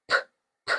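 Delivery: "p, p" as two tiny voiceless lip pops; P sound. Shown as a demonstration. Teacher showing the P sound, P sound. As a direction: pop each "p" with no vowel. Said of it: "P sound".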